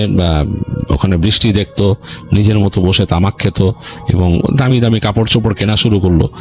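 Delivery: ordinary delivery, nothing marked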